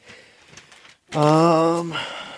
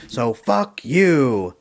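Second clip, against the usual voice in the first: slow, deliberate mock put-down; slow mock insult; 4 dB louder, much slower than usual. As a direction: drag out the insult slowly, lightly voiced grumpy cop. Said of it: "And he goes".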